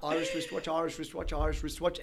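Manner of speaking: "Irish wristwatch" rattled off three times without a break; fast tongue twister. Shown as a demonstration. Guest showing host how it's done, rapid-fire "Irish wristwatch". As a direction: race cleanly through repeats of "Irish wristwatch". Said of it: "three times fast".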